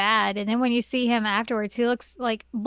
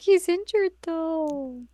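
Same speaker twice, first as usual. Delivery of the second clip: put-on high girl voice; 6 semitones higher, much slower than usual. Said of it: "I M A's like".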